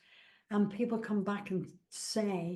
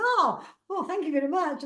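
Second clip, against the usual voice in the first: much higher and louder, delighted and taken aback; surprised thanks; 8 semitones higher, 6 dB louder than usual. Retold or imitated. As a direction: gush thanks like you've been caught off guard. Voicing surprised hugged stranger.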